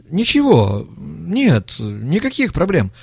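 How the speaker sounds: hasty denial. A narrator's reading. Young man denying trouble to the old man, flustered. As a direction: brush it off too quickly. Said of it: "замотал головой молодой".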